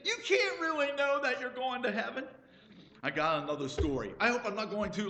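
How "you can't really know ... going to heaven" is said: mocking quote. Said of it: "And do you believe it?".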